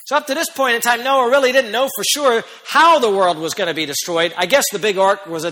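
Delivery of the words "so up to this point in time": normal talking voice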